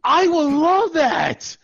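loud, high, drawn-out delight; gushing enthusiasm. Acted. Man hearing a girl told her family, gushing enthusiasm. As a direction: gush over it, really leaning on "love". Gushing agreement about being mentioned.

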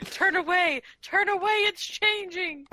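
high-pitched pretend alarm; mock panic. Joking about the full moon transformation, mock panicked cry. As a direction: cry out in hammed-up horror.

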